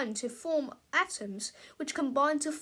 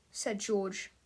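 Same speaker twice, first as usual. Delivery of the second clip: ordinary delivery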